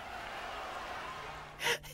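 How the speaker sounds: high, breathless, wheezing laugh; crying laughter. Real, not acted. Streamer loses it at the game, wheezing laughter. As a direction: silent wheeze of real laughter. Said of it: "Um, I'm crying".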